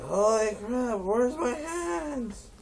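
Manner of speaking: high, slow panicked wail; stoned panic. Retold or imitated. Stoner panics in the smoke, high and slow.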